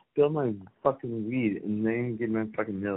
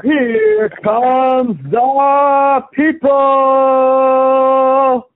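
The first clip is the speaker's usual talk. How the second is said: huge, drawn-out, much higher and louder boxing announcer call; 16 dB louder, much slower than usual. Shown as a demonstration. Guest mocking the host's podcast voice, bellowing announcer style. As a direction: belt it out like a ring announcer. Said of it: "fucking announcer voices".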